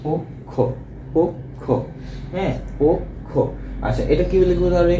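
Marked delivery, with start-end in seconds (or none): 0.1-3.3 পক্ষ broken into "pok", pause, "kho"; syllable-by-syllable pronunciation. Shown as a demonstration. Teacher spells out the sound for students, পক্ষ as "pok... kho". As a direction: say পক্ষ piece by piece with a closed o. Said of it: "তাহলে এটাকে আমরা বলবো হচ্ছে".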